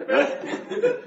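high laugh breaking through. Real, not acted. Teacher laughing at his own dog joke. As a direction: laugh at the silly idea.

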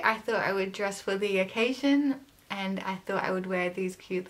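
speaking through metal braces; talking with braces on. Real, not acted. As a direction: mouth with fixed braces. Seen 3: braces glint on teeth while talking; close-ups after confirm.

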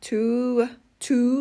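"two" with a long Danish y, not a plain oo; "two" drawn out with an ue-coloured vowel; vowel between u and ue. Shown as a demonstration. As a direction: stretch "two" on a tight ue-like vowel. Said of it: "อือ หรือสระอึ".

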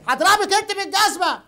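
angry threat. Real, not acted. Man threatens a woman with a shoe, angry. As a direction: throw the threat back heatedly.

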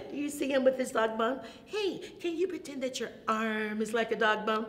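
usual speaking voice

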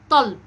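طلب with a front T replacing the qaf; child's misarticulation. Shown as a demonstration. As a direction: demonstrate the lisped طلب. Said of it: "كلمة زي قلب بينطقها". (نطق ألدغ، الطاء بدل القاف)

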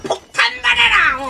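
high, loud, drawn-out bomb yell; slow shouted battle cry. Real, not acted. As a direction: hurl the words with the bomb. Throwing bomb at enemies.

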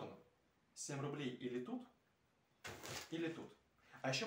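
chewing while talking; slurping noodles between words; mouth full of noodles. Real, not acted. Seen 1: lifts noodles to mouth at +0.1, likely chewing after.

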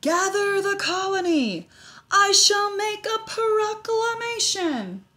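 high, loud, slow royal proclamation; heroic regal voice. A narrator's reading. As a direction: proclaim like a grand king. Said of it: "he said in a heroic, regal voice".

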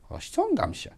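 put-on high, light toddler voice; little son. Dad imitating his toddler son's reply in a high little voice. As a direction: chirp it like a two-year-old.

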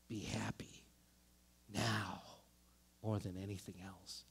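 whispered, soft and low; heart whispering. Acted. Preacher voicing the heart, whispered. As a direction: breathe it like a secret urge. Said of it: "Our heart told us".

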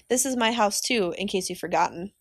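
ordinary delivery, nothing marked

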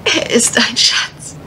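slow, dreamy whisper about her sweetheart; tender whisper. Acted. Miss Dean confiding about the man she loves, slow whisper. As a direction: breathe it out slowly like a secret.

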